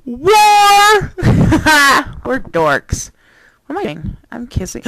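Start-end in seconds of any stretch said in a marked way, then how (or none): 0.3-2.2 loud high laugh; burst of laughter. Real, not acted. Laughing at herself.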